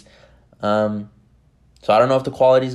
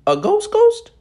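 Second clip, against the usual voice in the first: loud, very high-pitched movie-character voice; Ray Ray; 6 dB louder, slower than usual. Acted. Podcaster mimicking a movie clip about a ghost, Ray Ray impression. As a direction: squeal it out as a startled, high falsetto question. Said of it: "my best impression of Ray Ray".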